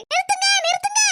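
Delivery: high shout to stop them; calling out. Acted. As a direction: yell after the bike to stop. Calling after departing bike.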